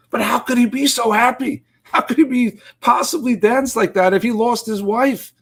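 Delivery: put-on a kid who can't make sense of it; high, confused child question; bewildered child. Child confused asks father.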